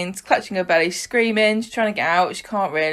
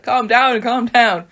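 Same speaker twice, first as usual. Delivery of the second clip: raised, louder imitation of calming someone; mock urgent calming; 5 semitones higher, 5 dB louder, slower than usual. Ed turning up as he's panicking, mock urgent calming. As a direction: cut through the panic, higher and firm.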